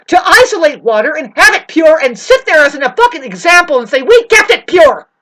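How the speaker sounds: loud, high, furious rant; angry rant. Real, not acted. Venting at the town over its water, loud and furious. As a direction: let the anger rip.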